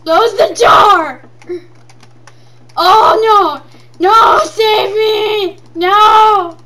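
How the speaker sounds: loud, high, slow drawn-out cries; hammed-up terror yells; panicked screaming. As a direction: scream for the door in mock terror. Zombies inside, screaming.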